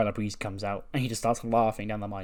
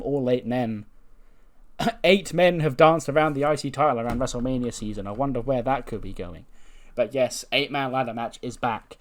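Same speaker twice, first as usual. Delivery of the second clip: ordinary talk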